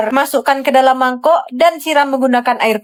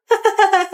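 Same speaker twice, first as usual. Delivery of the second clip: laughing; 7 semitones higher than usual. Acted. Laughs at confiscated phone.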